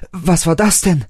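high, startled cry of alarm; erschrocken. A narrator's reading. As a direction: gasp it out in sudden fright. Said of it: "rief River erschrocken". (erschrockener Ausruf)

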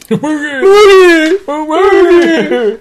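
high excited squeal; squealing name. Acted. Repeating the signed nickname.

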